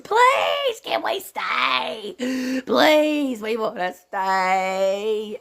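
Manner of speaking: mock kids wailing to stay; screaming kids begging. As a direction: beg like a child who won't leave. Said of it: "Half of 'em was screaming".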